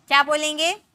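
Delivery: ordinary delivery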